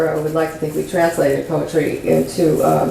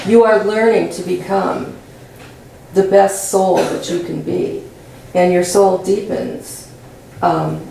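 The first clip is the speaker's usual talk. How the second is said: ordinary delivery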